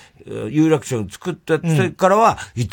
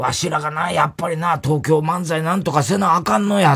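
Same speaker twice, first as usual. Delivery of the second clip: raised-pitch heavy Kansai dialect; Kansai accent; 5 semitones higher than usual. Retold or imitated. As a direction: imitate a fired-up Osaka veteran. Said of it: "バリバリの関西弁".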